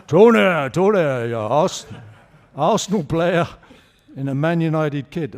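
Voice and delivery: loud, bantering call of his name; ribbing him loudly as the old manager; imitating Wenger's teasing. The manager teasing his captain about United, loud mock call.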